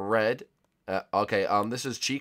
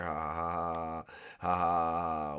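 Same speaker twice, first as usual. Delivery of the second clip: mock villain laugh; 6 semitones lower, 7 dB quieter, slower than usual. Taunting the seeker.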